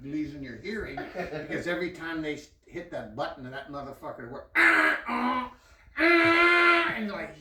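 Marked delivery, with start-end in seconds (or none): 4.5-6.9 loud high mechanical ah-ah blasts; imitated machine noise. Storyteller imitating the MRI machine, loud high blasts.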